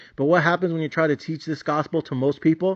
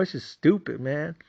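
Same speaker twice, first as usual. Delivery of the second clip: ordinary talk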